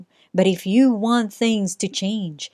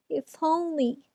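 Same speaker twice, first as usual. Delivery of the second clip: much higher and softer, a tiny wish; a shy little mouse sighing to himself; small mouse voice; 8 semitones higher, 8 dB quieter than usual. Acted. Mouse wishes quietly.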